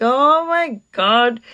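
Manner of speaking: slow, drawn-out exasperated groan; retold exasperation. Mother reacting to her begging son, exasperated. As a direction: stretch each word in weary dismay.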